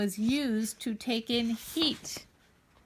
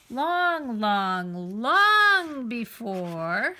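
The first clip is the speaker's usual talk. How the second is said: slow, stretched long, long, long; drawn-out storybook; 4 dB louder, much slower than usual. A narrator's reading. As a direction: draw out every long like a tale of ages past. Dramatic opening of a story.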